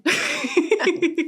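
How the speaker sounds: quick high laugh after her joke; real laugh. Real, not acted. Laughing after joking about the cut. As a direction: laugh it off, genuinely amused.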